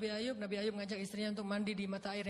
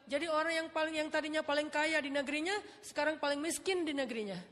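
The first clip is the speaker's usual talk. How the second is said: raised, higher preaching refrain, building; fervent preaching; 6 semitones higher than usual. Acted. Preacher to congregation, rising fervent refrain. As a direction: preach each loss with conviction.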